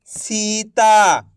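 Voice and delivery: सीता sounded out slowly, the long सी held and stretched; drawn-out blended word. Shown as a demonstration. Teacher to pupils, blending syllables: slow, stretched सीता. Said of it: "तो मिलके बन जाएगा".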